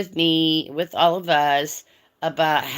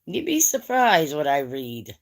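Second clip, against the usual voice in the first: normal talking voice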